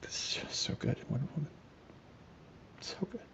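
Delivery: hushed, voiceless gushing; awed whisper. Real, not acted. Gushing to co-host over a beloved actress, awed whisper. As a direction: whisper it, overcome with admiration.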